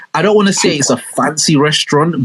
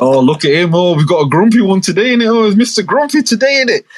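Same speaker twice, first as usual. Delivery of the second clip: sing-song teasing mum voice, pitched up; 6 semitones higher, faster than usual. Retold or imitated. Mum teasing her grumpy son in a sing-song. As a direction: coo at a grumpy kid like a mocking mum. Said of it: "she's like".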